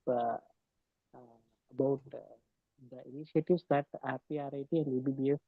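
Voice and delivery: very faint, low-volume voice; speaking much too softly to hear; barely audible. Real, not acted. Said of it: "sir, your voice is very low".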